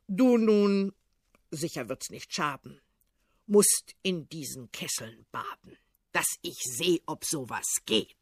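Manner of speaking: put-on low, cunning old tsar; deep-voiced tsar, crafty command; sly old tsar. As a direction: push the servant in first. Tsar orders Ivan.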